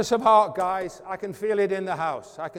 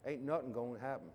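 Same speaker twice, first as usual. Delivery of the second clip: Texas accent; 6 semitones lower, 11 dB quieter than usual. Shown as a demonstration. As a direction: try on a Texas accent for fun. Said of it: "can I kind of try a Texas ac-, accent?".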